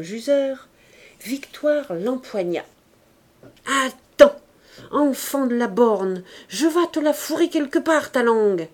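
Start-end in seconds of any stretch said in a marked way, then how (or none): 3.7-8.7 loud, high threatening snarl. A narrator's reading. Victoire threatening the girl who stuck out her tongue, loud. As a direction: threaten the brat loudly. Victoire grabbing cheeky Louise.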